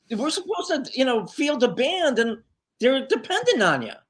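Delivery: mock-dismayed objection, voice pitched right up; incredulous protest. Bandleader to drummer backing out last minute, incredulous.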